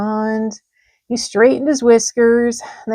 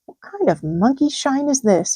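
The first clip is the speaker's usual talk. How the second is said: growling; 5 semitones higher than usual. A narrator's reading. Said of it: "he growled".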